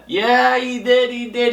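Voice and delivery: ordinary talk, nothing marked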